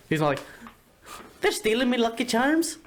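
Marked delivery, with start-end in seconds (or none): 1.4-2.8 high-pitched cartoon Irish leprechaun; over-the-top high Irish accent; exaggerated Irish accent. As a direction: squeal it in a silly Irish brogue. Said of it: "but he's not like".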